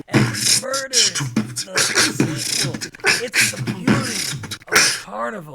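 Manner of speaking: loud rapping clown; Gamzee voice. Acted. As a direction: rap it loud and rough. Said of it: "how was my Gamzee".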